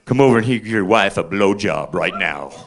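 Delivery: low, menacing cellmate voice; a deep, leering prison bully; cellie's punchline. Acted. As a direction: drop the voice down low and smug for the punchline.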